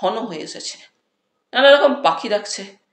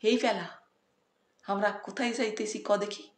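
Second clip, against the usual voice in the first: hushed, much softer voice; whispering; 11 dB quieter than usual. A narrator's reading. Habul asks his friend in the car, whispering softly. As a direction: whisper so the uncle won't hear. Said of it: "Habul ফিসফিস করে আমাকে জিজ্ঞেস করলে".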